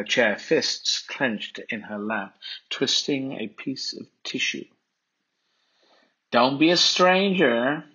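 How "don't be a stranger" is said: lilting, mocking farewell; slow, teasing sarcastic sing-song. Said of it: "her tone a sarcastic sing-song".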